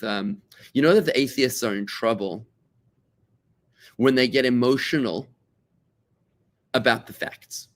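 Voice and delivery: ordinary delivery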